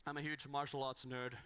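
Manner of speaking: usual speaking voice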